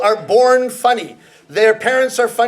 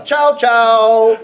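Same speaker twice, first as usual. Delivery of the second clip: doorbell chow; 5 dB louder than usual. Shown as a demonstration. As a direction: ring it out like a doorbell, laughing. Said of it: "a little bit like a doorbell".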